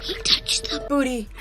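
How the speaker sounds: high, hushed parody; Finding Nemo quote. Acted. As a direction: echo the movie line in mock wonder. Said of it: "from, like, Finding Nemo".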